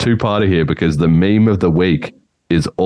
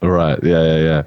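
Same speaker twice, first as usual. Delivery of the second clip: ordinary talk, nothing marked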